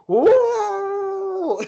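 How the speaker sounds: loud, very high sustained whoa; Brandon Billiter. Retold or imitated. Commentator mimicking his partner's knockout reaction, high long whoop. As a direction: imitate an amazed howl, held long. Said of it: "And Brandon Billiter's going".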